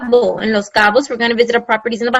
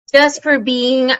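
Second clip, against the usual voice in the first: ordinary delivery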